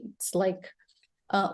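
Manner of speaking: ordinary talk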